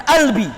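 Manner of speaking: "albi" the Egyptian way, q turned to hamzah. Shown as a demonstration. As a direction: say "albi" with a glottal onset, no q.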